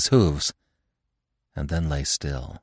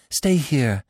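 much higher desperate plea; rising panic, beg him to stay; begging; 8 semitones higher, 4 dB louder than usual. A narrator's reading. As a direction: Marina begging her beloved to stay. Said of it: "Even Marina begged".

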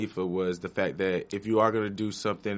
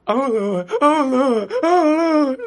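high, loud wailing "oh, Lord"; imitating screaming in pain; 10 dB louder, slower than usual. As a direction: wail it like someone under the knife. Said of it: "seeing her scream the Lord's name out".

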